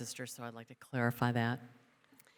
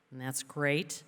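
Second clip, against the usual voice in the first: usual speaking voice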